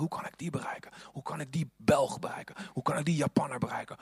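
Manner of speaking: soft whisper to himself; God pondering how to reach each person, whispered; whispered musing. Pretend. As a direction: whisper it like a private plan. God's inner thoughts voiced.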